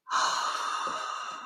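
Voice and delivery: long, soft gasp of amazement; stunned gasp. Real, not acted. Gift recipient seeing the surprise, stunned gasp. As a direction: suck in a long, astonished breath.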